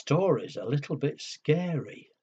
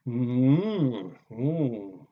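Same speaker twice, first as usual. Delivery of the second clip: contented dragon hum; 2 semitones lower, 4 dB louder than usual. Acted. Dragon tasting cake.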